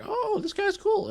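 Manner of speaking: squeaky curious viewer; high-pitched newcomer voice. Retold or imitated. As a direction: pitch way up like a stranger dropping in. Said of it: "And it'll be like".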